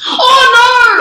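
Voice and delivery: loud, high-pitched wail; dismayed scream. Real, not acted. Player loses the point to opponent, screaming. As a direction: scream out as the point slips away.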